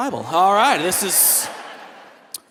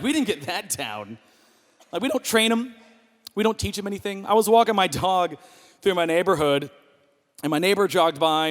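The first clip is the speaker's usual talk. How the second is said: normal talking voice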